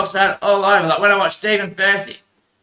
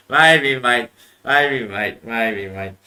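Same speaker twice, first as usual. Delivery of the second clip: fading mock echo; 8 semitones lower, much slower than usual. Mocking echo of own line.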